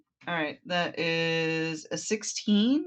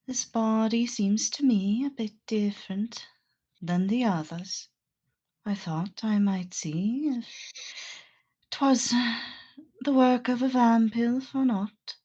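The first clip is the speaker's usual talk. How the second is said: higher, measured in-character musing; 6 semitones higher than usual. Acted. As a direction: speak as the investigating character, pitched up. Plays the character Aang in game.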